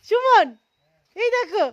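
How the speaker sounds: high loud call across the valley. Real, not acted. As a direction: shout the name out to the hills. Calling out for the echo.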